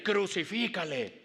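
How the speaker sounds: grito actuado, alto y alargado; grito de turba. Acted. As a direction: exige la condena como una turba. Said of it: "estaban ese día ya gritando". (gritando como la multitud)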